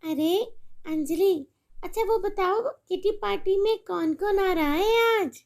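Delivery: making shopping plans with a friend; chatty mother on the phone. Acted.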